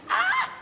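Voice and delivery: short, very high, loud squeal; excited squeal. Real, not acted. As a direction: squeal at a friend's greeting. Squeals while greeting a friend. (petit cri aigu et excité)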